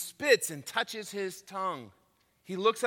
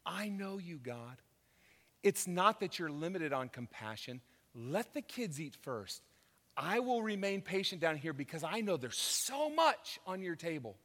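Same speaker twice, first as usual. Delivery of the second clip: much softer, hushed and patient; quiet trusting prayer; 2 semitones lower, 9 dB quieter than usual. Retold or imitated. Preacher voicing the woman praying to God, hushed and trusting. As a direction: pray it softly, patient and sure.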